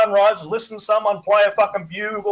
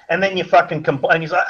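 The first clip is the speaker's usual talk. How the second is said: ordinary talk, nothing marked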